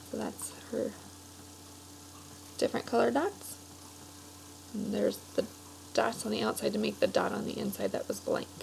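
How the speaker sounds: quiet commentary over the pictures; soft aside. Real, not acted. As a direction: drop to a murmur while pointing at the page. Aside while showing pictures.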